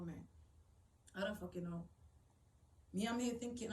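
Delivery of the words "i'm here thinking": ordinary talk, nothing marked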